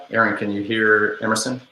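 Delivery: ordinary talk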